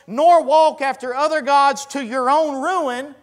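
high, loud scripture declamation; ringing out each phrase, high and forceful; full-force preaching. Real, not acted. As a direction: proclaim the warning to a congregation.

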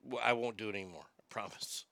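fast, whispered mock apology; sheepish whisper. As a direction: whisper a quick sheepish promise. Sheepish apology after accent bit.

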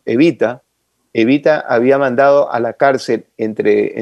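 normal talking voice

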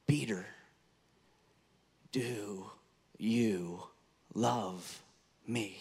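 "Peter, do you love me" with long pauses between the words, said low and soft; slow, word by word. Acted. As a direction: lay each word down slowly and softly. Jesus asks Peter the third time.

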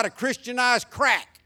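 preaching at full force. Acted. Pastor condemning church Halloween events, fiery loud preaching. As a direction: rail against it from the pulpit.

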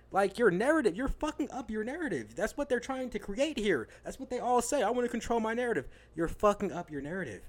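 getting mad. Real, not acted. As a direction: vent at the players, really worked up. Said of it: "And I'm getting mad".

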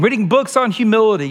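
ordinary talk, nothing marked

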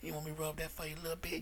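low, soft, fast seductive murmur; smooth masseur voice. Retold or imitated. Masseur sweet-talking the client on the table, low and soft. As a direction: purr it like a slick masseur working a client.

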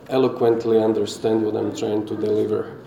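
usual speaking voice

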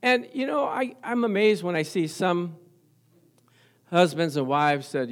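ordinary talk, nothing marked

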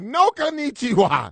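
high, loud mock-angry shout; imitating angry guy. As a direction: shout it indignantly, mimicking someone furious. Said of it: "The other guy was angry".

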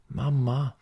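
a mild narrated call for mom. A narrator's reading. Small boy wakes and calls his mother, mild call. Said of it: "ropar han".

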